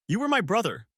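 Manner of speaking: high, anguished anger; growled accusation. A narrator's reading. Betrayed man in his friend's face, angry. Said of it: "I growled".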